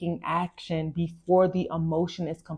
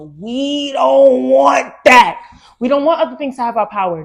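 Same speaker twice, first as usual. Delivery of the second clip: emphatic declaration; 6 semitones higher, 11 dB louder than usual. Real, not acted. Urging her viewers loudly and insistently. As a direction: hammer the point home.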